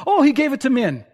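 mock crowd. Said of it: "for them to respond".

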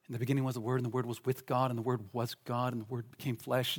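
low, soft scripture recital; hushed recitation. Acted. Preacher recalling the Word in the beginning, hushed recitation. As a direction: recite it softly under the main talk.

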